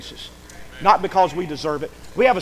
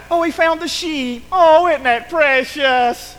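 high, drawn-out gushing; hammed-up delight, mocking the easily thrilled; mock excitement; 8 semitones higher, 6 dB louder, slower than usual. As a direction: gush over it in an exaggerated high voice. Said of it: "they get all excited".

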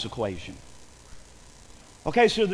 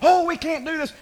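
higher-pitched mock complaint; acted grumbling; 9 semitones higher, 4 dB louder than usual. Preacher voicing the people before Moses, grumbling. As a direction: complain like a discouraged crowd. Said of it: "Boy, they were grumbling".

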